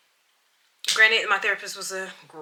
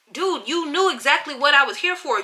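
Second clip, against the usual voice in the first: ordinary talk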